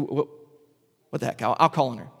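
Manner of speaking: ordinary talk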